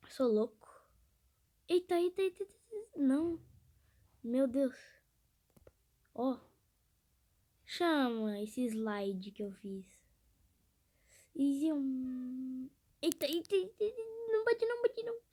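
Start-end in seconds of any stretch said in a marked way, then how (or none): 1.7-3.4 eita aflito. Real, not acted. Manobra arriscada no jogo. 13.0-15.2 eita em pânico. Real, not acted. Quase bate a moto.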